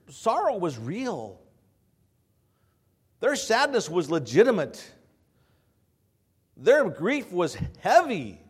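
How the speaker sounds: loud, high-pitched pulpit preaching, rising; preaching at full force. Acted. Pastor urging his congregation, preached at full force. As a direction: preach it out to the whole church.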